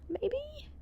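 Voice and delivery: tiny high unsure squeak; high quiet squeak. Acted. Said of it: "Maybe there was a polite way".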